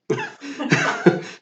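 a short high laugh at the story; real amused laugh. Real, not acted. Laughs at his own story.

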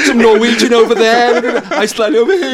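high, loud comic voice; mock accent practice. Co-host mocking shadow-played accents, high loud play-acting. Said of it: "Boring shadow playing accents".

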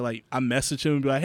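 usual speaking voice

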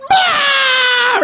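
high sudden shriek of shock; high startled cry of horror; horrified scream. Retold or imitated. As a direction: scream at the hammer blow. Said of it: "And I was like".

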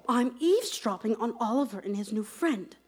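quick, soft confidential murmur; whispering. Acted. Nanny eavesdropping on the children, whispering. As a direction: keep it hushed and hurried. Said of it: "Why are we whispering?".

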